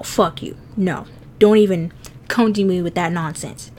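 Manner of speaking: normal talking voice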